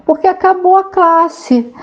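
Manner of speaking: high-pitched voice of a little schoolmate; child classmate's answer. Retold or imitated. Classmate telling Mafalda why he skipped class, high kid voice.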